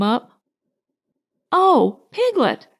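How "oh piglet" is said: high, eager call of a friend; pitched up with excitement; excited. A narrator's reading. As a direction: Pooh greeting Piglet, excited. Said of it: "said Pooh excitedly".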